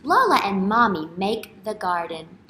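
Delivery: usual speaking voice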